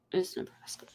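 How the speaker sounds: dry whispered punchline. Real, not acted. Teasing her home state to a friend, whispered. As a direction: whisper it as a wry aside. Joking about Nebraska jazz.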